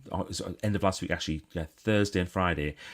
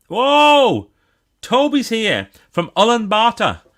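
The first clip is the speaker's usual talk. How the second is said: a big, loud, high whoa of surprise; real astonished exclamation; amazed whoa; 13 semitones higher, 10 dB louder, slower than usual. Real, not acted. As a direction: shout it out in delight. Reacting to a distant viewer.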